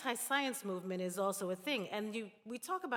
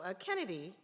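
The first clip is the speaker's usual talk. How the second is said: ordinary delivery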